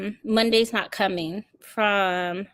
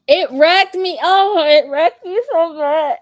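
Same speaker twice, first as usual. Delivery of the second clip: loud, high, drawn out wrecked me; emphatic; 9 semitones higher, 9 dB louder than usual. Real, not acted. Telling viewers the book wrecked her, loud emphatic. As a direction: lean hard into how it hurt.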